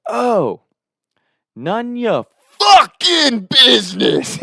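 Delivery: high, drawn-out mock scream; imitated scream. Buddy yelling in his friend's backswing, screamed. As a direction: belt it out slowly, way up high. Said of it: "And he screams".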